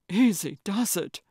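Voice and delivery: mildly winded; breathing hard. A narrator's reading. Calvin cautions his paddling partner, mildly out of breath. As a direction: say it with a touch of breathlessness. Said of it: "breathing hard".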